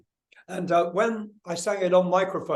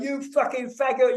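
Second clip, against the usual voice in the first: raised-pitch imitation of a jeering heckler; heckler calling out; 7 semitones higher than usual. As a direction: mimic a heckler's taunt, pitched up. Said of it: "calling out to me".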